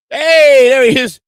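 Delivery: bursting out loud with a high call; loud greeting. Real, not acted. Host greeting the arriving guest with a loud call. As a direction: hail him loudly as he appears.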